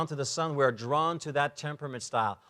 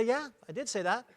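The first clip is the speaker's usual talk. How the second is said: higher, softer sheepish admission; agreeable high S; 7 semitones higher, 6 dB quieter than usual. Retold or imitated. The high S admitting the switch, sheepish and soft. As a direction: admit it meekly. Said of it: "And the highest would say".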